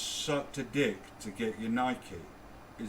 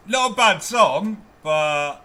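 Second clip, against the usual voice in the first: loud outburst; 7 semitones higher, 12 dB louder, slower than usual. Real, not acted.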